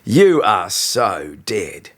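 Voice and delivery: slow, drawn-out deadly threat; a voiced bully, gloating and slow. A narrator's reading. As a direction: stretch each word to scare him.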